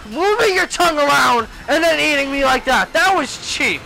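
exasperated outburst. Real, not acted. Gamer to the boss that ate him, indignant. Annoyed outburst at being eaten in game.

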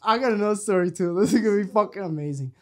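loud, laughing, rushing the words; laughing quote. Retold or imitated. Son mocking mom's excuse, laughing fast. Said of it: "And I'm like".

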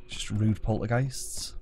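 ordinary delivery

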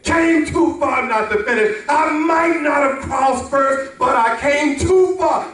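high, insistent testimony from the pulpit; impassioned preaching. Acted. Preacher voices the runner's resolve.

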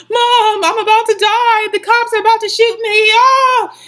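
high, loud frantic plea ending in a scream; panicked crying. Retold or imitated. Calling her mom during the arrest, frantic high cries. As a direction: beg your mom for help in a panic. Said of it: "I was crying on the phone".

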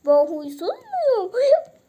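loud, high indignant pet voice; offended pet. Pretend. Pet Cesur objects to being called grumpy, loud and high. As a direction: pet squeals in outrage.

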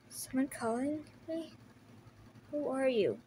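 weak faraway murmur; faint and fading. Acted. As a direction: murmur as if half conscious. Fading hero hears a voice.

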